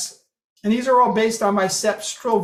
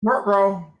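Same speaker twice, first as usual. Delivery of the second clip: dog voice. Retold or imitated. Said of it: "they've got a dog voice".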